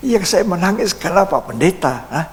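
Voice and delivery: high-pitched, insistent acted complaint; upset retort. Crying caller confronting the pastor, imitated upset retort. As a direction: throw the question back, pitched well up.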